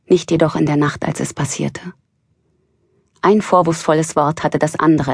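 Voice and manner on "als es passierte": hushed whisper. A narrator's reading. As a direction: breathe it out as a whisper. Ominous aside.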